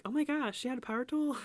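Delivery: high-pitched mock shock; imitated astonishment. Listeners who know her reacting, mock surprise. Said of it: "you're like".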